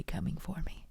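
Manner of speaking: fast, quick whisper; spooky whisper. Retold or imitated. Recalling scary basement taunt.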